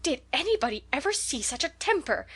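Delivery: high, shocked cry; horrified exclamation. Acted. Neighbour aghast at the girl's outburst, high and shocked. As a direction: gasp it out in horror. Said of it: "exclaimed the horrified Missus Rachel".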